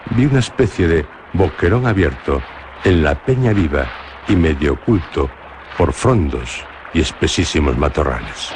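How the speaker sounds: ordinary delivery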